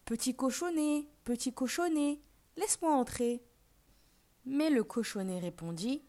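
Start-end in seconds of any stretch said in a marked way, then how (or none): 0.0-3.4 wolf calling out. A narrator's reading. Wolf calls at the first little pig's door, calling out. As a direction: call out coaxingly on a raised pitch. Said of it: "il s'écria".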